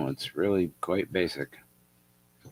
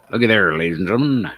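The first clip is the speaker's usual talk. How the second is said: showman announcer; 10 dB louder, faster than usual. Acted. Showman presenting hat.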